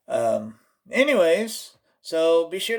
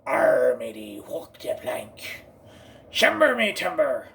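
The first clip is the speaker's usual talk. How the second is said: drawn-out arr, swelling into a shout; hammed-up pirate growl. Trying out pirates, hammed-up pirate. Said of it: "ain't done no pirates".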